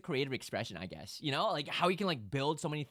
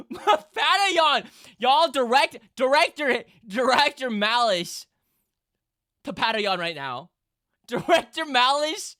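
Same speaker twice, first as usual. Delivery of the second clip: high, loud excited calling out; excited shouting; 8 semitones higher, 12 dB louder, slower than usual. Real, not acted. Streamer shouting at chat about the result, excited. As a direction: yell it at chat, amazed.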